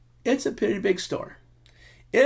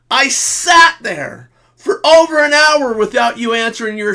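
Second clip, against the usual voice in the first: aggrieved shout; 8 semitones higher, 7 dB louder than usual. Retold or imitated. Husband quoting his complaint to his wife after the long wait, aggrieved shout. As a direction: lay out every grievance loudly. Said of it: "What you said was".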